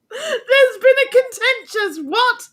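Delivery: high, slow, laughing mock outrage; cracking up at the misread word; laughing disbelief. Real, not acted. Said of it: "You just make your words up".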